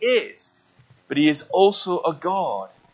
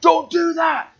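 high, loud shouted warning; 12 semitones higher, 3 dB louder than usual. Retold or imitated. Preacher voicing Indy, shouting a warning to Elsa. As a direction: yell to stop her. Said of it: "Indiana's going".

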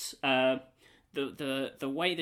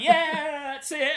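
smug player; 13 semitones higher, 8 dB louder, much slower than usual. Retold or imitated. Player voiced triumphant.

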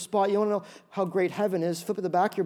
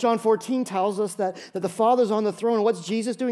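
ordinary delivery

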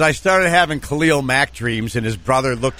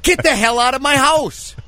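mock angry shout; 11 semitones higher than usual. Dad mock-throwing son out.

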